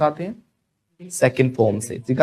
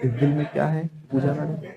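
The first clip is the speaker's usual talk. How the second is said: mock sorrow; 8 dB quieter than usual. Mock heartbroken teacher, softened.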